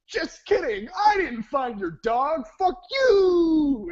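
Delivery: high, drawn-out gleeful shouting; taunting yell. Retold or imitated. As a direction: yell the cruel punchline down the phone. Prank caller taunts dog owners.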